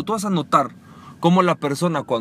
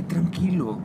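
soft, slow soothing calm; mock zen calm, softer; 4 semitones lower, 7 dB quieter, slower than usual. Shown as a demonstration. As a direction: play it overly zen and serene. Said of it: "con una energía calma y zen".

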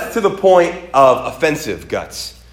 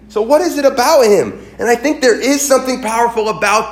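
fervent preaching; 10 semitones higher, 3 dB louder than usual. Acted. Preacher to the congregation, fervent full-force preaching. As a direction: rise up and hammer home why this man is chosen.

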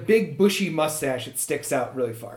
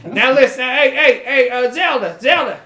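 louder calling for Zelda; 5 semitones higher, 6 dB louder than usual. Acted. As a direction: call across the office, loud and high. Boss calls out to secretary.

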